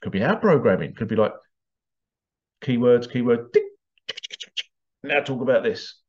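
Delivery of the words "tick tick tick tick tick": whispered tick tick; whispered ticking noise. Shown as a demonstration. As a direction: click out a clock's ticking. Imitated ticking brain.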